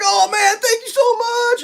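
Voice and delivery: very high, drawn-out gratitude; imitated owner's high-pitched thank-you; mock gushing thanks. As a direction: gush with relief, hammed-up.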